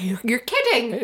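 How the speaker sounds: drawn-out, put-on incredulity; mock disbelief. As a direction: play up the disbelief, slowly. Acting out disbelieving reaction to story.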